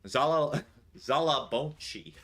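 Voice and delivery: a hammed-up American accent, laughing through Zala Bonchi. Asked by chat to say the name in a mock American accent. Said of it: "You want me to do my American voice?".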